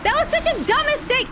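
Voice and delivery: high, loud self-scolding cry; real exasperated shout; frustrated outburst. Real, not acted. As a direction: yell at yourself for the blunder. Frustrated after dying.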